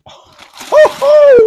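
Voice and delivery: very high, loud gasp of surprise; surprised oh. Real, not acted. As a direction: react with delight at the gift. Child opens present.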